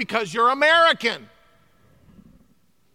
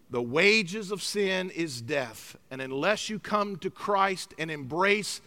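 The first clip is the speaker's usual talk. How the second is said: solemn warning; 5 semitones lower, 5 dB quieter than usual. Acted. Solemn verse, slow and quiet.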